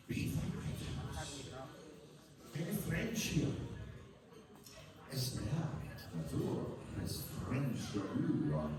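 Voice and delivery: declaimed Burns poem with a Scottish lilt; poem recitation. Acted. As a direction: recite it like a Burns Night address.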